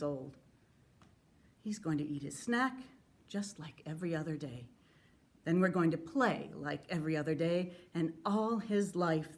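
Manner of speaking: measured, sincere public reading of a letter; earnest letter reading. Acted. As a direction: read it with restrained grief and defiance. Reads a widower's letter to killers.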